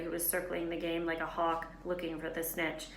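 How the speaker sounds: ordinary delivery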